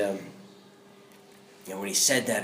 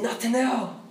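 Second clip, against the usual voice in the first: earnest address; 12 semitones higher than usual. Retold or imitated. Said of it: "I said".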